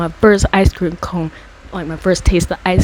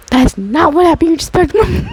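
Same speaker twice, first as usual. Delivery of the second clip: much higher, protesting the lie; a kid insisting on the truth; high, indignant retelling of the argument; 11 semitones higher, 4 dB louder than usual. Retold or imitated. Retold argument about the ice cream.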